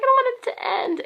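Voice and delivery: whiny sad. Retold or imitated. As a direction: whimper it like a sad kid. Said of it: "I was like".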